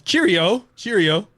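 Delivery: put-on high, chirpy British "Cheerio" accent; a put-on British goodbye; mock British cheerio. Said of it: "they did accents".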